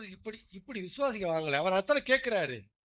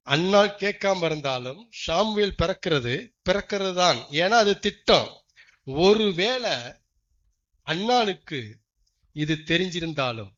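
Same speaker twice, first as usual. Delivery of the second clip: slow, loud declaration; loud preaching; 7 dB louder than usual. Acted. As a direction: proclaim it to the whole church. Preaching proclamation. (உரத்த பிரசங்க அறிவிப்பு)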